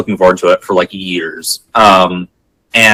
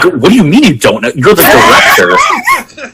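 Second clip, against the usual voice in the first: incredulous; 9 semitones higher than usual. Retold or imitated. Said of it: "People were like".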